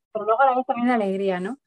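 ordinary delivery

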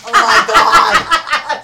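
high, loud laughing exclamation; cracking up mid-sentence. Real, not acted. Both laughing at bracelet.

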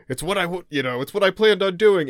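high, loud gruff snap; angry. Retold or imitated. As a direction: bark it defensively. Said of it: "he's just like".

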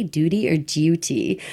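duty first with a plain oo, then duty with a y-glide; two rival pronunciations. Shown as a demonstration. Co-host questioning how they say everything, two pronunciations. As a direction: weigh "duty" as doo-tee against "duty" as dyoo-tee. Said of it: "Do I say duty or duty".

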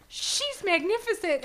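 lightly acted awestruck line; high, admiring quote; slightly dramatised admiration; She-Ra. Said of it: "She-Ra says".

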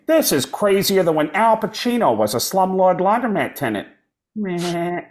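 high cartoon-dad impression; Peter Griffin. Voice actor recording a cutaway setup, Peter Griffin impression. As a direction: put on a goofy cartoon voice for the whole line. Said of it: "kept doing one line as Peter Griffin".

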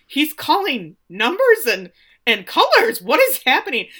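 high, loud incredulous commentary; baffled announcer. Retold or imitated. Announcer watching a strange trick play, loud baffled cry.

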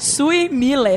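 slow American English name, laughing; American accent. Shown as a demonstration. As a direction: say it like an American, amused. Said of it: "Agora você tem que falar".

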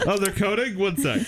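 high, light put-on voice; mock distracted resident. Resident juggling crying baby and coding patient, mock-casual. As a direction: toss it off as a comic aside.

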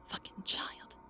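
bitter unvoiced whisper. A narrator's reading. Woman alone, whispering contempt for the departed man. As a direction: spit it under her breath. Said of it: "she whispered".

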